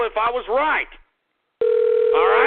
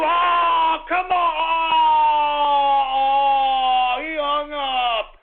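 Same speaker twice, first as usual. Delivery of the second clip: high, loud cry of protest; frustrated shout; 4 semitones higher, 3 dB louder, much slower than usual. Real, not acted. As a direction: yell after the caller hung up. Caller hung up, host outraged.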